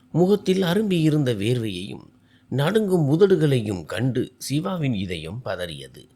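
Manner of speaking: ordinary delivery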